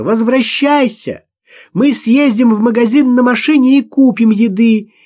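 high, loud coaxing shout. A narrator's reading. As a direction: shout her back from the distance. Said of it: "закричала Наташа". (громко зовёт, высоким криком)

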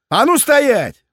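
high loud stop order; shouted command. A narrator's reading. Cop yells at drunks to halt, loud command. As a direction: freeze them with a shout.

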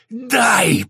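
high, loud roaring demand; drawn-out furious roar. A narrator's reading. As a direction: bellow it in a rage. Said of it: "проревела она".

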